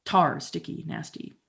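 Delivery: ordinary delivery, nothing marked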